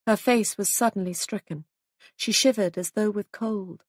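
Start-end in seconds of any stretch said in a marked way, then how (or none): none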